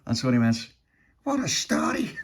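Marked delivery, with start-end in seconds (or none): 1.2-2.1 high-pitched character voice; story character voice. A narrator's reading.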